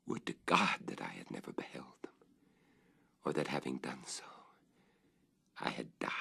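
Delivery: fast, hushed, breathless whisper; despairing whispered regret; anguished whisper. Acted. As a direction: whisper it as if the wish hurts. Narrator's anguished wish.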